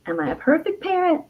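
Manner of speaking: usual speaking voice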